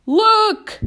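high, loud urgent call; centipede's cry. A narrator's reading. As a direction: cry out to get everyone's attention. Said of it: "cried the centipede".

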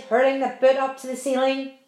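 ordinary delivery, nothing marked

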